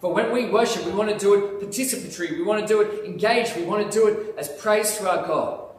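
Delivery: ordinary talk, nothing marked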